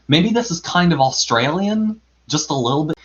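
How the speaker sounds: a hint of Australian for Rabbit's voice; faint Australian accent. Shown as a demonstration. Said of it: "this is definitely Australian".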